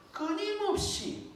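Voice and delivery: 끊임없이 held high and drawn out; high, insistent stretch on 끊임없이; stress on 끊임없이. Real, not acted. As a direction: pitch 끊임없이 way up and sustain it for emphasis. Preacher hammers the key word.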